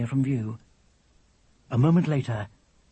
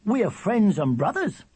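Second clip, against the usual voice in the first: higher, grand declaration; lofty; 7 semitones higher than usual. A narrator's reading. William explaining his generosity to his mother, loftily. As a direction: proclaim it with self-importance. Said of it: "said William loftily".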